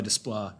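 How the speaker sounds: Desplat French-style, final T silent; French pronunciation. Shown as a demonstration. Guessing the composer's name is French, Desplat with a silent T. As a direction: say Desplat ending on "plah". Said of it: "I think it's French".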